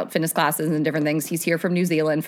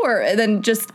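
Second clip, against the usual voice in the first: ordinary delivery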